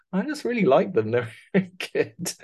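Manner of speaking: high, delighted imitating voice breaking into a laugh; mock fond gush. Retelling actor's fondness for braces.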